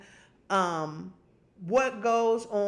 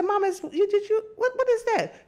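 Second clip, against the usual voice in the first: shocked questioning; 11 semitones higher, 3 dB louder than usual. Retold or imitated. Scolding mother about the tea.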